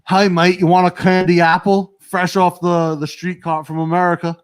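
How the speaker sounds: playful put-on Australian accent; mock Australian. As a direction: imitate a chirpy Australian server pitching food, lightly. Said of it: "And they're like".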